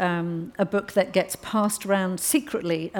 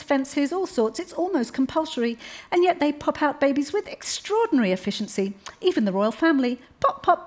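put-on higher, sweetly pointed young woman at the dinner table; a higher, overly polite voice that needles the men; Alice, polite but pointed; 7 semitones higher than usual. Alice mocks the men's claims.

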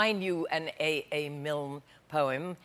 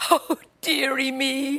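slow, higher mock sob; sobbing; 6 semitones higher, much slower than usual. King sobbing to the queen, slow, pitched up and hammed. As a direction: wail it like a sulky child. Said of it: "The king sobbed".

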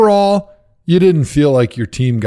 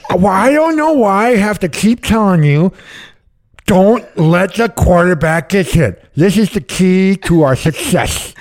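Eric Mangini; 6 semitones higher than usual. Retold or imitated. Coach scolding Joe Thomas in a team meeting, thick lisp. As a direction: lisp heavily round a big wad of dip, exasperated. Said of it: "sounded like he had the worst lisp".

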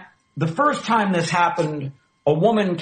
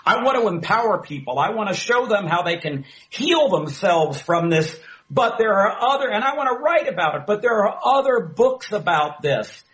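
pitched well above usual, imitating her urgency; retold earnest plea; 7 semitones higher than usual. Retold woman's plea about her dream.

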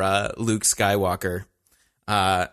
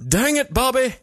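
Hank Hill; 14 semitones higher than usual. Retold or imitated. Hank Hill yelling at his son Bobby, exasperated. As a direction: scold like a fed-up Texas dad. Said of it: "Hank Hill".

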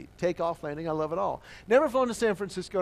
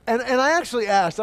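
normal talking voice

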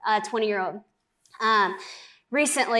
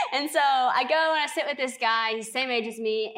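ordinary talk, nothing marked

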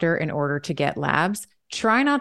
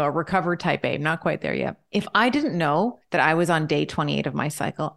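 usual speaking voice

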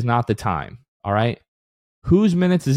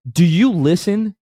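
exasperated; 5 semitones higher than usual. Real, not acted. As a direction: demand it of the listeners, fed up. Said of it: "I'm getting a little too emotional here".

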